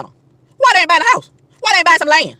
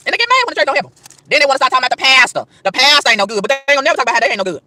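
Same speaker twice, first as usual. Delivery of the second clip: ordinary talk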